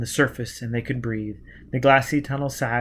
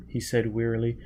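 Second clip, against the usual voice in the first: ordinary delivery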